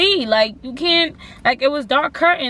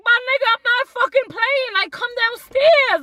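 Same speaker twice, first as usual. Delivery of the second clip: urgent plea; 11 semitones higher than usual. Retold or imitated. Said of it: "I'm not even yelling".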